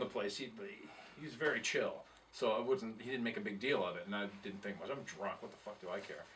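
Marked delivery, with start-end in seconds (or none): none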